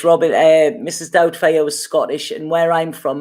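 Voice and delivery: normal talking voice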